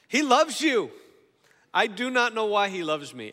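loud, high, emphatic proclamation; full-force preaching. Real, not acted. Preacher speaking a word over the congregation, loud impassioned preaching. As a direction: preach it out, punching the key words.

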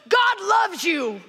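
high, slow, full-volume yell; screaming match. Acted. Norm preaching at an angry stranger, screaming. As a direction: yell it as if winning an argument. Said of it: "they begin a screaming match, and it looks like this".

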